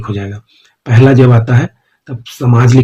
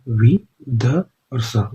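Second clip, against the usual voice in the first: spelled out slowly; 8 dB quieter, much slower than usual. Shown as a demonstration. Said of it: "विदेशों के लिए क्या करेंगे".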